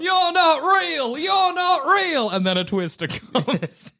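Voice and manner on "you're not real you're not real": high, slow, taunting shout; mock shout. Players yelling up at a cursing old wizard, high slow taunt.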